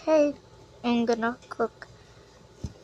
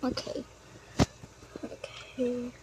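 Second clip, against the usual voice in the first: quiet; 14 dB quieter than usual. Acted. Calm reply after shouting.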